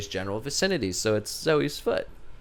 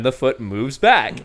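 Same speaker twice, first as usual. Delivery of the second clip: usual speaking voice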